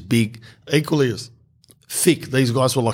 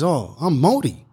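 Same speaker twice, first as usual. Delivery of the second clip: put-on higher, lightly done Māori accent; 5 semitones higher than usual. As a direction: say it like a proud Māori guy. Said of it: "Where's the accent from".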